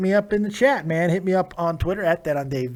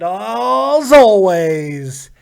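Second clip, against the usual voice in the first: announcer voice; 2 semitones higher, 9 dB louder, much slower than usual. Acted. Signature sign-off line.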